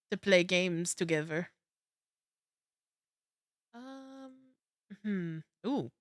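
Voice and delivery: ordinary talk, nothing marked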